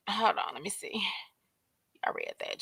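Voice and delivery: breathy whispered aside; whispering. Real, not acted. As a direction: whisper to yourself. Aside while checking.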